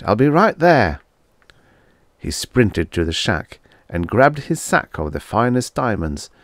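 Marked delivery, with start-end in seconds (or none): 0.0-1.0 shouting. A narrator's reading. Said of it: "Sherwood shouted".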